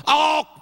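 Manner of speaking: loud high yelp of surprise; acted yelp. Preacher acting out his wife's love taps, mock yelp. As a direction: yelp as if just smacked. Acts out being smacked.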